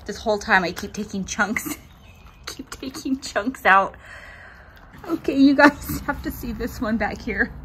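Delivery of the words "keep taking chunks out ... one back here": laughing through it. Real, not acted. Showing viewers the chopped patch, laughing. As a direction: keep laughing as you show it. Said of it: "I'm laughing so hard".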